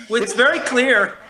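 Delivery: a sudden loud burst of certainty; emphatic loud. Real, not acted. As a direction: cut in hard and loud. Emphatic point in heated debate.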